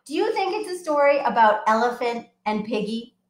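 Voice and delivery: plain reading voice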